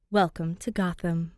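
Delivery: ordinary delivery, nothing marked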